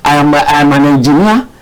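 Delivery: usual speaking voice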